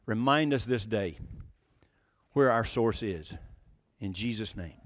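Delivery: low, soft prayer with long pauses. Real, not acted. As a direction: speak humbly to God, pausing between phrases. Leads the congregation in prayer.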